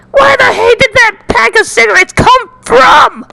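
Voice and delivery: high, loud panicked yell; cracking high cry of panic; panicked outburst. Acted. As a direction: shriek it in disbelief.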